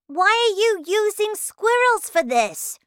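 put-on high, loud boy's voice; loud, high-pitched challenge; questioning boy. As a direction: demand it like a pushy child. Mike questions Wonka, acted.

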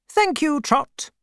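put-on a kindly, no-nonsense old aunt greeting an old acquaintance; elderly aunt. A narrator's reading. Aunt's voice, held.

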